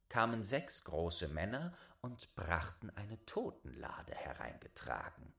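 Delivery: hushed, breathless storyteller whisper; spooky whisper. A narrator's reading. Narrator whispers as the coffin arrives.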